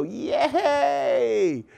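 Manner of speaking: squealed high, laughing yay; mock cheer. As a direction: cheer like a dad who is free at last. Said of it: "we think about that and we go".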